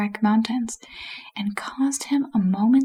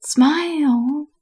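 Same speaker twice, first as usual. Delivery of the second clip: rasped command, pitched higher; raised, drawn-out rasp; 5 semitones higher, 4 dB louder than usual. A narrator's reading. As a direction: rasp it out, stretching the word. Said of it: "rasped Rincewind".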